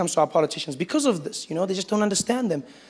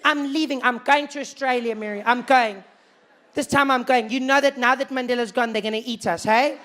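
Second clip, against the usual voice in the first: worried emigrant; 4 semitones higher than usual. Retold or imitated. Panicking emigrant again.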